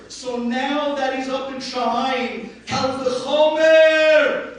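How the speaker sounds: loud, high, slow climax; preaching at full force. Acted. Rabbi proclaiming to the mourners that the sage prays for them, loud and slow. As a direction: drive the point home to the crowd.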